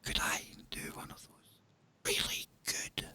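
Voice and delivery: breathy ASMR whisper. Shown as a demonstration. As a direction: whisper close to the mic. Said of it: "is this mic good for A S M R".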